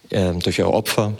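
ordinary talk, nothing marked

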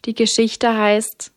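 plain reading voice

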